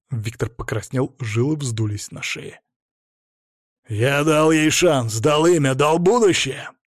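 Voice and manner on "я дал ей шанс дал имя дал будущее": loud, slow-starting, insistent self-defence; explosive angry retort. A narrator's reading. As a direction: hammer out each claim, rising to the end. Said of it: "Виктор взрывался в ответ". (взрывной гневный ответ)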